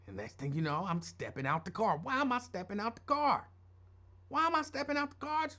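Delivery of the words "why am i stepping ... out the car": high-pitched mock bewilderment; imitating a puzzled, worried kid; retold bewildered question. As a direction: ask it pitched up, not understanding why. Retold alarm at being ordered out.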